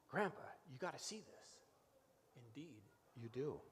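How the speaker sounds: ordinary talk, nothing marked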